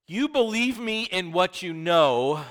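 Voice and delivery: voicing Jesus. Preacher voicing Jesus' words.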